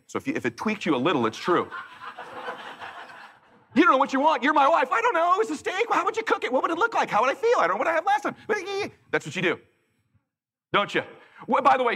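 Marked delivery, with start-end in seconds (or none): 3.7-8.4 mocking indecisive voice.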